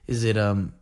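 ordinary talk, nothing marked

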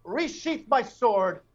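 grand, hammed-up warrior's farewell; mock noble swordsman. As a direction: proclaim it like a theatrical knight. Playing a swordsman signing off.

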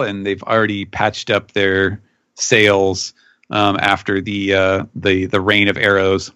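ordinary delivery

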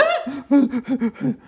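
high mock squeal breaking into laughter; little high-pitched pretend scream; imitated scream. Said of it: "perfekt nachgemacht".

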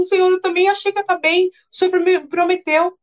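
wife crying out to the Lord in a hotel room; tearful complaint to God. Retold or imitated. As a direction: plead that the promise was broken. She answers God, crying.

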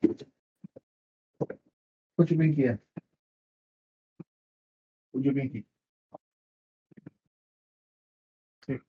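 very soft, coaxing a little one to settle. Real, not acted. Aside to a small child.

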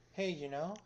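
lightly voiced Adam. Imitating the friend lying on the couch, lightly crackly little voice. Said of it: "in his crackly little Adam voice".

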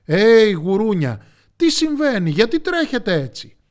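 big, loud high-pitched hail; loud, puzzled storybook call. A narrator's reading. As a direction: shout after a running crowd. Elephants call to running pigs.

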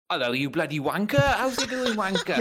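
mock British insult. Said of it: "we just go around with".

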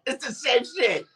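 normal talking voice

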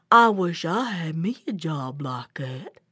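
low, ponderous bear voice; wistful bear. Acted. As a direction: wish for it in a deep, simple voice. Slow bear's envy.

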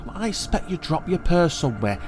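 Aunt May, conciliatory. A narrator's reading. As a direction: defend the child in a high, placating voice. Aunt defends the boy.

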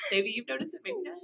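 teasing words through a laugh. Real, not acted. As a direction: tease while still laughing. Laughing while teasing her friend.

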